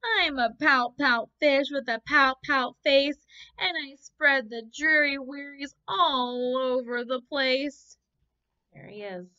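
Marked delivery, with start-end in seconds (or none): 0.0-8.0 mopey, louder sing-song fish lament; gloomy fish voice. Acted. Teacher voicing the sulky fish's refrain for the class. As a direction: moan it like a glum fish. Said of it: "you can say it with me".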